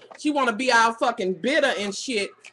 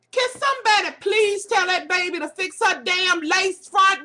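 much higher, louder, fed-up plea; exasperated outburst; 9 semitones higher, 6 dB louder than usual. Real, not acted. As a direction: throw up your hands and shout the complaint to the room. Ranting about a bad wig.

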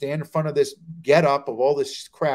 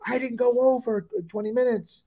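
higher-pitched, self-justifying reply; mock defensive protest; 7 semitones higher than usual. Young comic answering the headliner, defensive protest. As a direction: protest innocence in a raised pitch.

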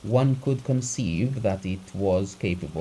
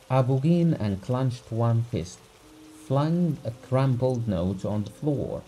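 ordinary delivery, nothing marked

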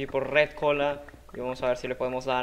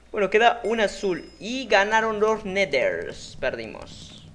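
ordinary talk

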